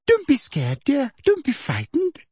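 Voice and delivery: very high, sugary reassurance; syrupy comforting of a frightened pupil; soft coaxing. A narrator's reading. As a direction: soothe her in a high little voice. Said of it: "said Professor Umbridge softly".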